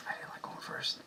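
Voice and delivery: breathy whispered aside; hushed admission. Real, not acted. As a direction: whisper it as a confession. Quiet confession.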